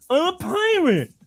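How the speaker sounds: very high cleft-palate imitation; hare-lipped little boy. Little boy telling the lady his costume, hare-lip voice. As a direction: pipe up as a hare-lipped kid. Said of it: "I'm gonna tell the joke in hair lip".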